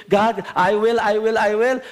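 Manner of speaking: a new believer blurting yes; hammed-up eager pledge; eager mock vow. Eager convert responding.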